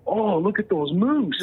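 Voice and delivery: slow, high-pitched mock exclamation; outdoor voice. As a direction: imitate a full outdoor voice, high and drawn out. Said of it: "in a full, like, outdoor voice".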